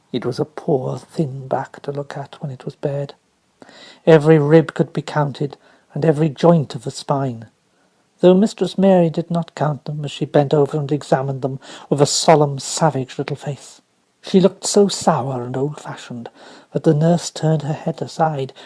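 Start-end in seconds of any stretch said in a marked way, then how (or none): none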